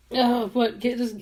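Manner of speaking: normal talking voice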